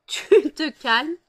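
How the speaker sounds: half-laughing, half-shouting at the cat; laughing scold. Real, not acted. Owner separating fighting cats, laughing loud scold.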